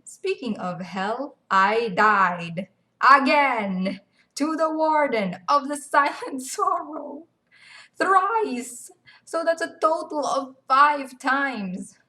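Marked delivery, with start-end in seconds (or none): 1.5-12.0 loud, dramatic wail of defeat; high, anguished announcement of yet another loss; exasperated outburst. Real, not acted. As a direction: cry it out like a gamer's tragedy. Mock-despair rant about dying again.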